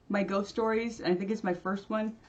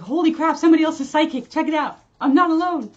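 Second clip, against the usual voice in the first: higher and louder, thrilled exclamation; excited; 8 semitones higher, 9 dB louder than usual. Retold or imitated. Thinking someone else is psychic, excited high and loud. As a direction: blurt it out like you just found a fellow psychic. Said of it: "I was like".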